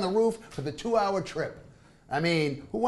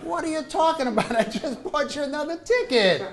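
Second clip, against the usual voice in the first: incredulous; 9 semitones higher, 3 dB louder, faster than usual. Retold or imitated. Husband incredulous.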